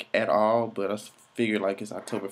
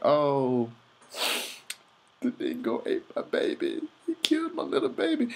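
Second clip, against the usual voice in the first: mock distraught mother; 6 semitones higher than usual. Mimicking the mother's famous line.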